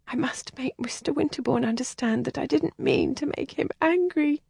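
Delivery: put-on a sheltered girl close to tears; upset young lady. Helen confides in Kathleen, high and distressed.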